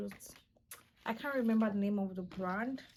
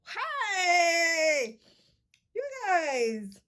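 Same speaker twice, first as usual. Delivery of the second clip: high, loud excited cry; excited scream; 6 semitones higher, 7 dB louder, much slower than usual. Real, not acted. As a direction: shout with delight at viewers. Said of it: "I don't know why I'm screaming".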